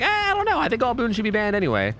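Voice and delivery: high dismissive shrug of a voice, lightly mocking; imitating Swish. Streamer quoting his friend about banning boons, mock dismissive. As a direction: toss it off like a teammate shrugging. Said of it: "and he was like".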